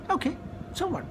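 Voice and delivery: high, soft, offhand reply; uninterested shrug. Retold or imitated. Retold indifference to friend's initiation news.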